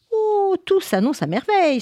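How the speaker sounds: high delighted exclaim; rejoicing. Acted. Ratatouille rejoicing over her plan, high and delighted. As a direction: crow with glee. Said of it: "se réjouit Ratatouille".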